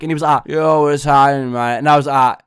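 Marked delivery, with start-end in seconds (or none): 0.5-1.8 slow, slurred mock-drugged greeting; imitating slurred speech. Spaced-out friend greets him from the bathtub, slurring slowly. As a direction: slur it as if your mouth is falling apart. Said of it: "sounded like his mouth was falling apart".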